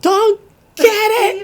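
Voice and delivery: high, loud laughing shout; a mock yell breaking into a laugh; retold shout at kids. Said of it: "I'm like".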